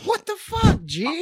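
shocked. Pretend. Reacts to mom insult.

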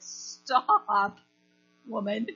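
loud drawn-out stop, then a firm address; mock-scolding halt; firm interruption. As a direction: cut them off loudly and firmly.

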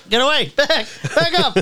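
hammed-up fright, laughing; mock scared. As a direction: squeal back up like a spooked victim. Mock fending off crazy lady.

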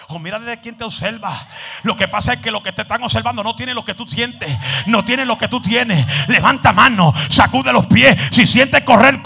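fiery Pentecostal preaching shout; preaching at full force. Acted. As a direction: rouse the congregation to let loose. Preaching crescendo to congregation.

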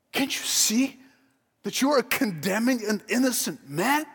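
high, rising preacher's fervour; fiery preaching. Acted. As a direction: build the sermon up, pleading. Preaching at full force.

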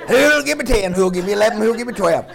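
loud, high rapid-fire auction call; auctioneer. Pretend. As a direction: play an auctioneer taking bids. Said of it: "this is not an auction".

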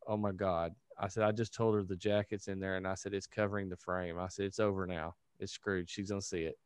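low, hushed retelling of dismay; quietly reenacting a sinking realisation; dismayed aside. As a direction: say it under your breath, resigned. Recounts dismay to Mark.

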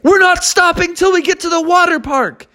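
high, squeaky stubborn yell out the window; dad shouting at the police; defiant father bear. Acted. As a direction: refuse to stop, shouting.

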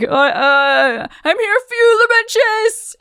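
very high, nervous little voice; squeaky timid soldier. Retold or imitated. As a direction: squeak it out like a scared grunt. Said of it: "He's like".

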